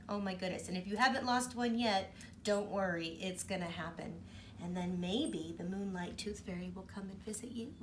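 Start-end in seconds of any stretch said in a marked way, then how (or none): none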